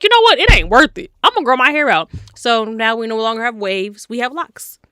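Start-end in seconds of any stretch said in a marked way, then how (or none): 0.0-2.1 much louder and higher; mock fed-up outburst; fed-up declaration. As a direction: announce it like you're done. Retold decision, fed up.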